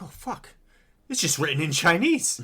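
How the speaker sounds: high startled outburst; sudden alarmed realization; surprised. Retold or imitated. Shocked at finding the notebook.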